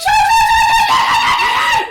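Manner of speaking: piercingly high, very loud scream; screamed retort. Acted. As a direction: draw out a screeching yell. Character shouting back, acted.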